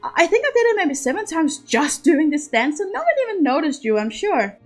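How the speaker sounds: put-on a chatty young noblewoman comforting a friend; eager young lady. Anastasia speaks, eager and kind.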